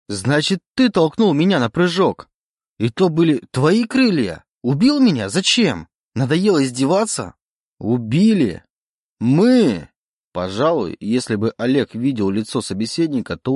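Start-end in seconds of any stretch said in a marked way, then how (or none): 0.1-7.3 high, agitated string of questions; accusing. A narrator's reading. Oleg accuses the voice of killing him, agitated. 7.8-9.9 louder, higher indignant questions; affronted disbelief. A narrator's reading. The voice answers Oleg's accusation, affronted.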